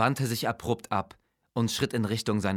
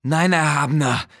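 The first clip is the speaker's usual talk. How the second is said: higher, louder, hurried servile vizier; quick denial; 7 semitones higher, 10 dB louder than usual. Acted. Vizier hastily denies doubting his master, higher and louder. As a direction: blurt it out to appease the king. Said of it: "antwortete Arkan schnell".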